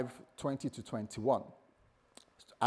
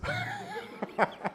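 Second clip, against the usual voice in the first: shrieking giggle, way up high; high squealing laugh. Real, not acted. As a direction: let out a squeaky burst of laughter. After his Wales joke.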